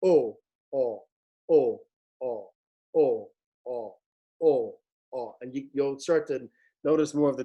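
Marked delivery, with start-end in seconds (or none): 0.0-5.3 long pure "O" without a glide; pure O vowel. Shown as a demonstration. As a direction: hold a clean "O" each time. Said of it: "just go".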